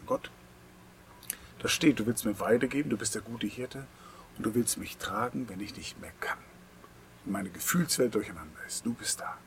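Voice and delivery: a spoken prayer that sinks to a whisper; sample prayer. Shown as a demonstration. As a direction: pray it softly to God, trailing into a whisper. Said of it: "das kannst du ins, ins Gebet packen". (leises Gebet, das ins Flüstern übergeht)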